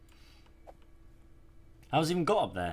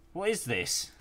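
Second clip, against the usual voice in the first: "What is this?" yelled high and loud; disgusted shout; 10 semitones higher, 6 dB louder, slower than usual. Real, not acted. Gamer recoiling from something gross in the game, loud high shout. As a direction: blurt it out much higher and louder, repulsed.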